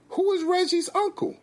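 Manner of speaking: very high, incredulous question; high-voiced bewildered wife; baffled mother.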